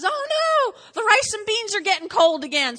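high, loud oh no of a flustered cook; mock panic. Martha's sudden kitchen panic.

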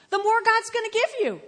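high, loud payoff; full-force preaching. Real, not acted.

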